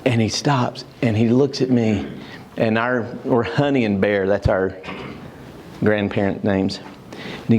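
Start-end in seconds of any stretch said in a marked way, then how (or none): none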